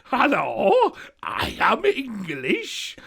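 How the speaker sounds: loud, high, slow parody English; hammed-up foreigner imitating English; mock English accent. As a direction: caricature an English accent, stretched out. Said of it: "someone doing an accent of us".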